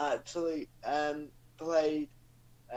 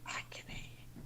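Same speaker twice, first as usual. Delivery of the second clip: very soft whisper; 16 dB quieter, much faster than usual. Pretend. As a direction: keep the joke going in a whisper. Whispered reply in whispering bit.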